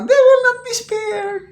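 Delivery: high, laughing mock of an unserious vow; mock giggly promise. Commentator mimics an official laughing off the investigation, high and mocking. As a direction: parody a promise made while chuckling. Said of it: "tatawa-tawa lang na".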